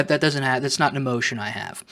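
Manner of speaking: normal talking voice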